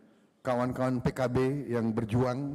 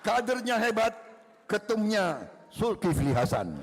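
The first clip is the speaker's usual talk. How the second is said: higher, louder triumphant shout; shouted punchline; 8 semitones higher, 4 dB louder than usual. Real, not acted. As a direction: belt the punchline to the crowd. Pantun punchline cheered to crowd.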